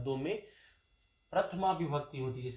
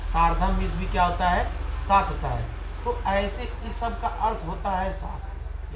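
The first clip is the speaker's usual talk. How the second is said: emphatic teacher; 3 semitones higher, 9 dB louder than usual. Real, not acted. Teacher drilling word meanings.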